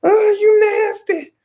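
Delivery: very high, loud, slow squeal; disgusted kid squeal. Retold or imitated. Classmates mocking his snotty hair, high squeal. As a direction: drag it out like shrieking grossed-out kids. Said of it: "they was like".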